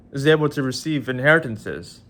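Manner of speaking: normal talking voice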